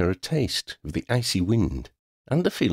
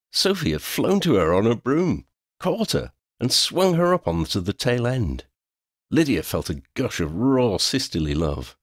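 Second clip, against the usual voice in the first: usual speaking voice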